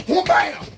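very loud whoom bam; mock punch sound. Attacker's punch landing on the narrator. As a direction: blast the hit noise. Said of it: "One of the boys was like".

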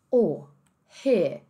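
o and re ending on open vowels; British dropped R. Shown as a demonstration. Teacher summing up both cases, British dropped R. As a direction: leave the R off o and re. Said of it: "em ambos os casos".

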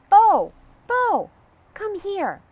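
high, loud call of Bo's name; excited girl calling her friend. Acted. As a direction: call across the woods to Bo. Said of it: "yells Donna".

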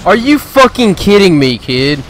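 high, loud raging shout at the screen; furious yell. Real, not acted. Gamer raging at a player who noob-tubed him, furious yell. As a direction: scream it out at the cheater.

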